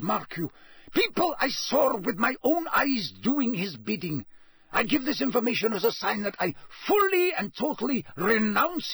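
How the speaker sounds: high, gasping eagerness; breathless. A narrator's reading. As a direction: stammer it out. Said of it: "said Karkaroff breathlessly".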